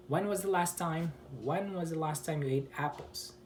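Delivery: high start, "apples" dropping at the end; falling intonation. Shown as a demonstration. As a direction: bring the pitch down on "apples". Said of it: "It goes down".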